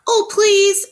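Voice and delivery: high, loud begging rabbit voice; pleading rabbits. Acted. Rabbits begging the butterfly to play, high pleading. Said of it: "said the rabbits".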